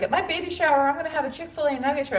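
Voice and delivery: high-pitched mock quote of a friend; put-on high voice for an excited announcement; imitating friend. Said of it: "she was like".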